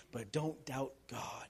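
slow hushed whisper. Real, not acted. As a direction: drop to a whisper to land it. Whispered emphasis after shouting.

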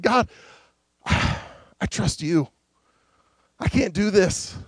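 soft, slow sigh into a hushed prayer; surrendered prayer. Acted. David praying to God, soft and surrendered. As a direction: let go, almost breathing the words.

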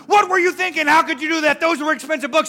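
retold yelling. Teen yelling at mom who threw out his books, furious. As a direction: blame mom at full volume for tossing the books. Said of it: "I started yelling at her".

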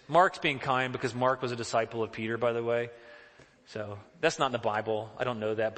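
ordinary talk, nothing marked

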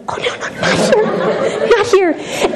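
high breathless laugh through the words; laughing embarrassed protest. Retold or imitated. Embarrassed teen laughing at her friend witnessing. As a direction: giggle it out, mortified.

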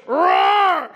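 loud mock roar; hammed-up roar at the end of a post. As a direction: let out a playful lion roar. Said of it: "And she goes".

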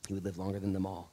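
ordinary talk

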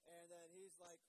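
ordinary delivery

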